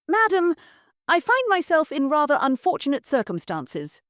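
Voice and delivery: lightly voiced refined gentleman; polished stranger asking politely; cultured gentleman. Stranger at door.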